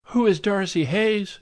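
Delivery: high society lady echoing; high gushing echo. A narrator's reading. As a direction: parrot the question back in a high gush. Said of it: "she echoed".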